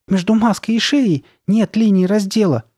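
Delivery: high-pitched, frightened statement; trembling voice. A narrator's reading. Sabrina explaining what is wrong, voice trembling. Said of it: "проговорила Сабрина дрожащим голосом".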